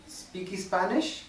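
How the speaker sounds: put-on slow learner's English with a Spanish accent, rising on Spanish; a Spanish speaker trying out broken English, with a Spanish accent. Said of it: "Spanish speakers, when they're learning English".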